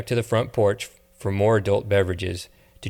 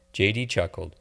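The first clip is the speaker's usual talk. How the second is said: ordinary delivery, nothing marked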